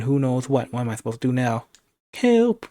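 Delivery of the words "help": high, loud desperate yell; cry for help. A narrator's reading. Narrator alone with monster crying out, desperate yell. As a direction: cry out sharply for rescue. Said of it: "My he cries".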